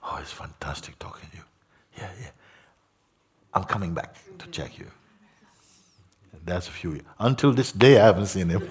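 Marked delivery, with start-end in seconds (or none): 0.0-4.9 whispered, hurried goodbye; hushed farewell. Retold or imitated. Teacher whispers a hasty goodbye to Mooji while leaving. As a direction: slip away with a quick whisper. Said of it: "Then Muji".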